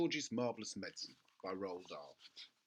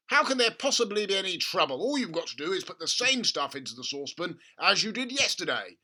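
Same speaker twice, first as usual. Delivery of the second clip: much higher, much louder excited dad; gushing over a money-making idea; excited father; 6 semitones higher, 12 dB louder than usual. A narrator's reading. Father urging his son at breakfast, excited. Mr Cranky voiced.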